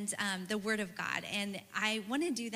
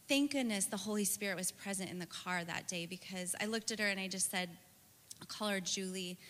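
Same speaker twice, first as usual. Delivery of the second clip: ordinary talk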